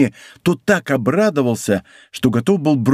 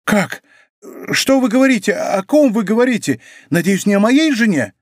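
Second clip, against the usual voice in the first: high-pitched, agitated questions; alarmed questioning; 7 semitones higher than usual. A narrator's reading. Bonacieux fears it is about his wife, alarmed questioning. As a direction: fire off anxious questions, pitch well up.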